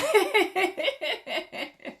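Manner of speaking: high laugh trailing downward; falling run of high giggles. Real, not acted. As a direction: let out a high-pitched laugh. Laughs after her confession.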